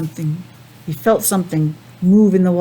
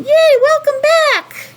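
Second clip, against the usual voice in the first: very high, happy welcome; little penguin voice; 4 dB louder than usual. Acted. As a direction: greet a friend coming home. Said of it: "this little penguin saying".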